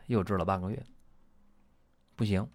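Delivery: normal talking voice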